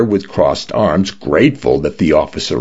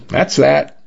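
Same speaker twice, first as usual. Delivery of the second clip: higher-pitched, falling brush-off; offhand, case-closed finality; dismissive shrug; 9 semitones higher than usual. A narrator's reading. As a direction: close the matter with a verbal shrug. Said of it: "with a shrug".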